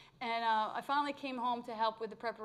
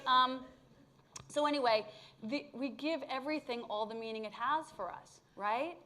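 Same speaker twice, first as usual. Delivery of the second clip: ordinary talk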